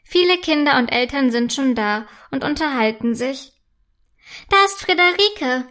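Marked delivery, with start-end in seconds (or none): 4.5-5.7 put-on a little girl spotting her best friend, calling out. A narrator's reading. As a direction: call out to your friend. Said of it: "ruft Mera".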